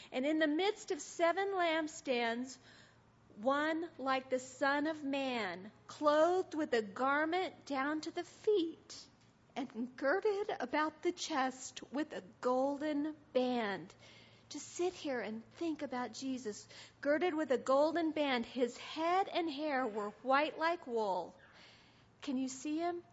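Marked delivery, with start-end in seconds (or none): 0.1-13.9 slow, weighty Bible reading with stretched stresses; scripture reading. A narrator's reading. Describing Jesus from Revelation, slow emphatic reading. 17.0-21.4 slow, weighty Bible reading with stretched stresses; scripture reading. A narrator's reading. Describing Jesus from Revelation, slow emphatic reading.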